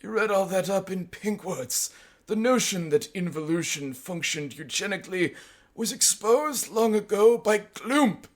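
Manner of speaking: high put-on professorial scorn; scornful. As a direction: scoff in a high, mock-academic voice. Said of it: "I replied quite scornfully".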